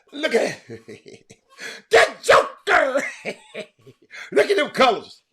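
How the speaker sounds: high, gleeful crowing through laughs; laughing delight. Real, not acted. Host showing viewers his jersey's colors, laughing with delight. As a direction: laugh while showing it off proudly.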